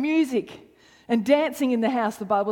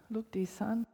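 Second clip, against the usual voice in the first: whispered, gentle father; tender whisper; 3 semitones lower, 9 dB quieter than usual. Acted. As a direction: breathe it to the son softly. Father gently answers his son.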